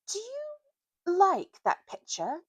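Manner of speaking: put-on high, slow sneering boy voice; Eustace's voice. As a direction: draw it out like a smug schoolboy.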